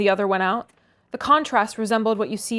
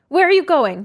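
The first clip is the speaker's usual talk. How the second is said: high, loud demand; 6 semitones higher, 8 dB louder than usual. A narrator's reading. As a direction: stop him at the door. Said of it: "demanded Catherine".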